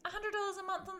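high-pitched, laughing incredulity; squeaky, laughing shock; amused disbelief. Real, not acted. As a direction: repeat it in giggly astonishment. Astonished at friend's subscription spending.

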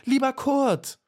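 very high-pitched cry for help; calling out desperately. A narrator's reading. Trapped little bee calls to the beetle below, desperate cry. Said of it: "rief sie, so laut sie konnte".

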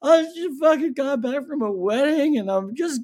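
high, loud choked-up plea; upset retelling. Retold or imitated. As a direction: explain to the cop, near tears. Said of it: "I was like".

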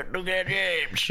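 cartoonish South Park style American accent. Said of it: "a bit South Parky".

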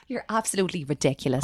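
normal talking voice

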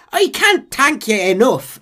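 high, slow hammed-up Irish brogue; drawn-out comic Irish thanks. Irishman thanking John, slow Irish accent. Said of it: "the voice is like".

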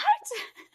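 high shrieking laugh. Real, not acted. A burst of laughter.

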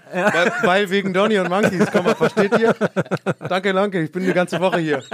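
loud, high stand-up comic; hammy comedian. Pretend. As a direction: sell the bad joke proudly. Mock stand-up bow-out.

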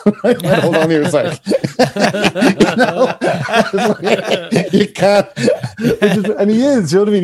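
can barely get the words out for laughing. Real, not acted. Guest cracking up over the piano player, laughing.

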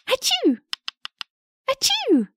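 high fake sneezes. Shown as a demonstration. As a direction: sneeze it out twice. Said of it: "the pepper is making the woodpecker sneeze".

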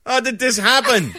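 high, loud mock-baffled parents; mock dismay. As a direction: throw up your hands at the name Bill, laughing. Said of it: "And they're like".

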